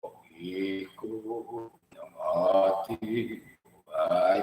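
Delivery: low, slow, unnatural sung line; artificial voice. Shown as a demonstration. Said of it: "Artificial voice".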